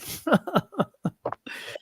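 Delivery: high, soft laugh in a run of bursts; real laugh. Real, not acted. Speaker admitting he hasn't been forgiven, laughing. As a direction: laugh it off after the admission.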